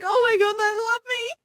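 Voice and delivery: high, choked-up, near tears; overwhelmed by kindness, voice breaking high; tearful gush. Retold or imitated. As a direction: let the voice crack with grateful tears. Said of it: "it makes you cry even more".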